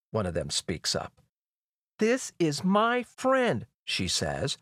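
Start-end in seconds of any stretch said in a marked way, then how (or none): 2.0-3.7 high, slow, emphatic declaration; regal, drawn-out insistence; princess commanding. Acted. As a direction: spell it out slowly from on high. Princess giving guards an order.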